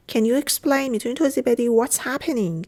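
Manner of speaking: ordinary talk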